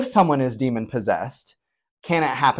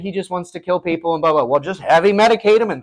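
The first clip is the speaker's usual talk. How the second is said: higher, mocking know-it-all voice; mock dismissive doctor; 7 semitones higher than usual. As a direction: sneer it like a smug expert.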